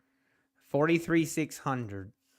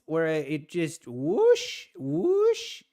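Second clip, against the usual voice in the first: mimicking whoosh; 3 semitones higher, much slower than usual. Trader showing viewers how the price moved. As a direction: paint the price shooting away with a whoosh.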